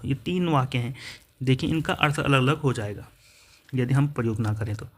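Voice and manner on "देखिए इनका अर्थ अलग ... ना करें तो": usual speaking voice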